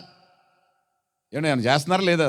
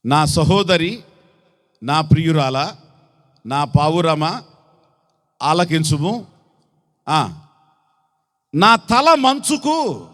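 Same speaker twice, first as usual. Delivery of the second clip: raised, declaiming Bible reading voice; scripture reading; 2 semitones higher, 3 dB louder than usual. Acted. Preacher reads the bride's lament to the church, declaiming. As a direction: proclaim the verse to the congregation. Reads Song of Songs aloud.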